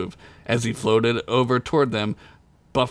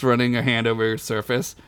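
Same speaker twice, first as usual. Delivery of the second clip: plain reading voice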